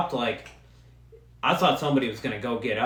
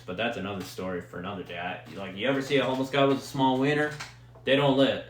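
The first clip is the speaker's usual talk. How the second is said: ordinary delivery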